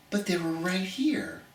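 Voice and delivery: soft unvoiced whisper. Real, not acted. Teacher confiding to students that the treasure was nearby, whispering. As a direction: let the surprise slip out as a secret. Hidden hikes close to home.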